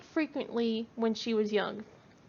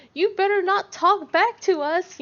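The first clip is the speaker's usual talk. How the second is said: loud, higher mock-stern scolding; mock stern warning; 7 semitones higher, 7 dB louder than usual. Parents teasing their toddler, mock stern and loud. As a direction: pretend to lay down the law.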